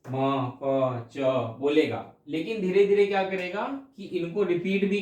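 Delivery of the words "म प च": put-on म, प, च each said alone in a baby-like voice; baby babble syllables. Shown as a demonstration. Teacher listing the first sounds a baby makes. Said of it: "सिर्फ बोलेगा".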